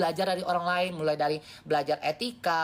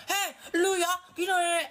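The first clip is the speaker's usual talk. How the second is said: tantrum demo; 11 semitones higher than usual. Shown as a demonstration. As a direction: throw a hammed-up fit at someone. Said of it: "Itu tantrum".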